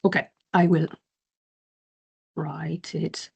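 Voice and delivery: ordinary talk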